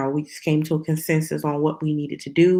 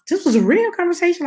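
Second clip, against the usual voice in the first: emphatic aside; 13 semitones higher, 6 dB louder, faster than usual. Real, not acted. Insists the exchange really happened.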